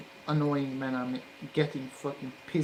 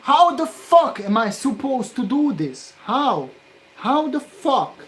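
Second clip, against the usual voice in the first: much higher, much louder furious yelling; furious shout; 9 semitones higher, 11 dB louder than usual. Real, not acted. Solderer yelling at the impossible job, furious shout. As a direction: scream the question at the board.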